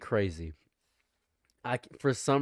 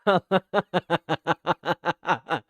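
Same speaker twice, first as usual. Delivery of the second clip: laugh; 5 semitones higher, 4 dB louder than usual. Real, not acted. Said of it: "I need this guy as my ringtone".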